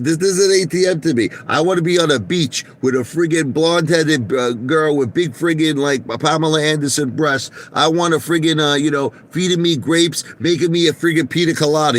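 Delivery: lightly hammed-up greedy executive; mocking CEO voice. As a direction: gloat about the beach life as the CEO. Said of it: "He says".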